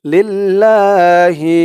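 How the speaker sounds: লিল্লাহি with a held, doubled lam, slow; slow recited লিল্লাহি. Shown as a demonstration. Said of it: "এক আলিফ টান".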